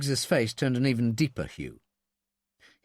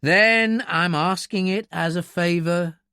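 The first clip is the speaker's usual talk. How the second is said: slow, higher-pitched, insistent plea; weary pleading; 5 semitones higher, 5 dB louder, slower than usual. A narrator's reading. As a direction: plead for a favour, wearily. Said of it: "Mallory pleaded wearily".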